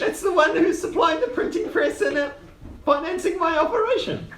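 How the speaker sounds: very high-pitched little gnome; gnome character. Acted. Gnome explains a printing press backer, high gnome voice.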